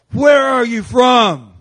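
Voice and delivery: slow, loud, barking demand; slow hostile interrogator yell; angry cop shout. Retold or imitated. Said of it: "this angry police officer gets in his face".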